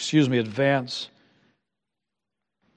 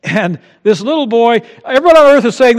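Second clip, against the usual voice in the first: usual speaking voice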